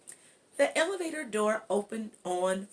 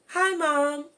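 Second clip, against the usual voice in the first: put-on higher and louder, bright child's call; girl greeting mom; 8 semitones higher, 7 dB louder than usual. Natalie greets her mom.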